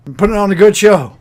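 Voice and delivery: loud, brash promoter voice; gruff fight promoter. Acted. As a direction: talk like a seedy fight promoter. GM voices ring announcer.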